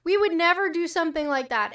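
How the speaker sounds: loud, high, sarcastic denial; mock the smug official line; sneering imitation of a spokesman. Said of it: "Israel said".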